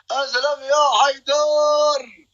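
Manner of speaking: high, slow, drawn-out shout; long cheering call. Real, not acted. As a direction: holler the name high and long. Cheers on battle supporter. (صيحة تشجيع عالية ممدودة)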